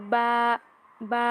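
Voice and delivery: বা said plainly with both lips pressed together; letter sound demo for students; Arabic letter ba. Shown as a demonstration. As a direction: close the lips and release বা naturally. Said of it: "এভাবে normal করে পড়তে হবে".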